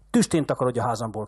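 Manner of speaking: usual speaking voice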